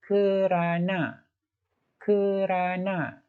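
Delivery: kerana stretched out slowly twice; teacher drilling kerana; slow drilled word. Shown as a demonstration. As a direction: say kerana slowly for the class to repeat. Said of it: "Yang keempat".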